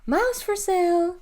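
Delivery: high, drawn-out vendor's call; yelling. A narrator's reading. Sellers hawk as the car passes, yelling. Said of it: "Debt Barns yelling".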